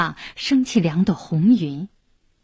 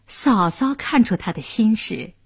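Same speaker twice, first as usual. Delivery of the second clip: plain reading voice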